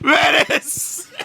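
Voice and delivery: shouting. Real, not acted. Chanting the name, laughing.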